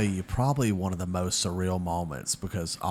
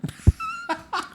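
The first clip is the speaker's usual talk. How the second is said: burst of laughter. Real, not acted. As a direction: crack up at the question. Reacting to the teasing question.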